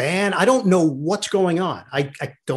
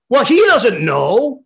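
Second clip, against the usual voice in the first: slow, high, loud retold objection; hammed-up dismissal, drawn out and raised; mock indignant; 8 semitones higher, 7 dB louder, slower than usual. Father dismissing his son's answer, mock indignant.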